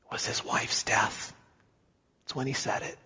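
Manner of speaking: whispered, solemn aside; hushed whisper. Real, not acted. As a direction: drop to a whisper for the death. Solemn aside about wife's death.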